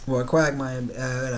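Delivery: ordinary delivery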